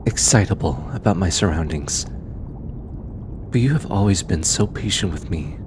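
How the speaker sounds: lightly voiced researcher, fond and teasing, with thoughtful pauses. Researcher thanking their stubborn bodyguard, fond and sincere. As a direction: talk gently to a trusted companion, unhurried, hesitating between phrases.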